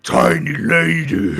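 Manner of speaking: slow loud bellow of a big Minotaur. Acted. Minotaur greeting Siren, slow booming shout. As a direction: boom it out like a huge bull-man. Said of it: "he's like".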